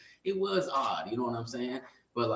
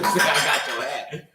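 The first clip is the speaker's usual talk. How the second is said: mocking quote; 8 dB louder, slower than usual. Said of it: "funny as fuck for that boy to say".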